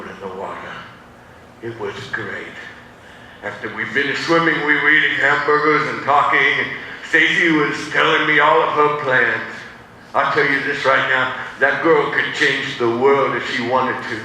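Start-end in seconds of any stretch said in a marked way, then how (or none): none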